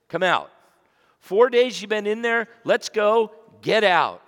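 loud commanding call from the tomb's mouth; booming command to come out. Acted. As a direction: summon him out, loud and firm. Jesus calling Lazarus from grave.

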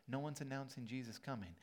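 normal talking voice

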